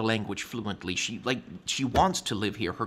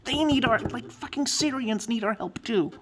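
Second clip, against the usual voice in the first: angry outburst; 8 semitones higher than usual. Real, not acted. Heated rant about refugees.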